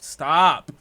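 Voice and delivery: sharp, loud order to stop; loud scolding. Real, not acted. Streamer telling chat to stop, loud scolding. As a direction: shut them down loudly.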